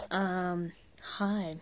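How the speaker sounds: ordinary talk, nothing marked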